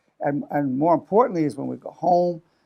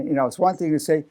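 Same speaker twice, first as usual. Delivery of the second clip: ordinary talk, nothing marked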